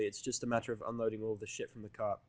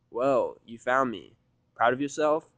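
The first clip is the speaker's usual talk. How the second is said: deadpan; 8 dB louder, slower than usual. Acted. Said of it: "I deadpan".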